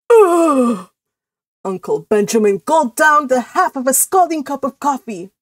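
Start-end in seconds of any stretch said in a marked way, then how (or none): 0.1-0.9 loud, high falling groan; disgusted ugh. Acted. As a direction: groan it out in exasperation. Disgust at end of character's rant.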